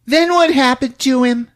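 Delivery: high, loud, anxious question; spooked kid. Retold or imitated. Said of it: "the kids are spooked".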